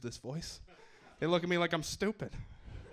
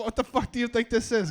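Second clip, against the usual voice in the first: ordinary talk, nothing marked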